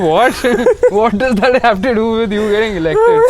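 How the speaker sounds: exasperated disbelief, lightly hammed up; mock incredulous. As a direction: act out laughing bewilderment. Acting out baffled reaction to insult.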